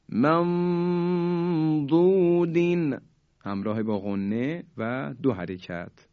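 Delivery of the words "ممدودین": ممدودین slow with two-beat nasal hum; ikhfa with ghunna. Shown as a demonstration. Teacher showing ghunna of two beats. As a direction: hold the hidden nun for two beats. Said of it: "دقت بفرمایید".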